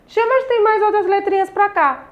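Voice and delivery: put-on child voice. Teacher voices a pupil's question.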